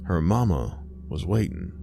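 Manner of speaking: ordinary delivery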